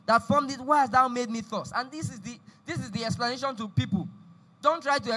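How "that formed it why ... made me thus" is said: forceful, raised-voice Bible reading; scripture read aloud. Acted. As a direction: declaim the passage, driving the key words. Reads scripture aloud to congregation.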